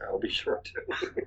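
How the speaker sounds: slow conspiratorial whisper. Real, not acted. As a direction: whisper it back slyly. Playful whispered reply.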